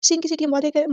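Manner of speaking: ordinary talk, nothing marked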